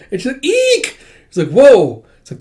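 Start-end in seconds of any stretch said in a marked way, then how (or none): 0.4-1.0 very high squeal of fright; startled squeak. Acted. 1.5-2.0 startled whoa. Acted. Character startled.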